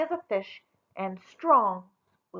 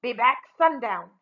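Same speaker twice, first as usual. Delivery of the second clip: warning; 6 dB louder than usual. A narrator's reading. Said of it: "he warned".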